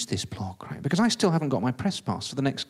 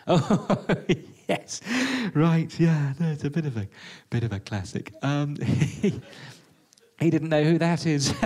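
fake laughing; 3 semitones higher, 3 dB louder, slower than usual. Bluffer pretends to get the joke, laughing falsely. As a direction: talk through forced chuckles.

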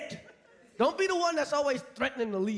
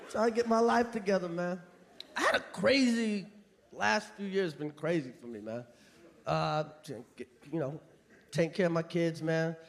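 mock sad-sack guy; 9 semitones lower than usual. A sad guy telling the crowd his troubles, low and tired. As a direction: play a worn-out man mumbling his troubles.

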